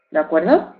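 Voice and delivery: ordinary delivery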